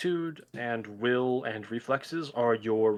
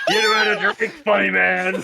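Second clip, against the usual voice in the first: high, loud voice through laughter; laughing imitation; 6 semitones higher, 7 dB louder than usual. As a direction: laugh while quoting it back. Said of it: "It just sounds like Roderick".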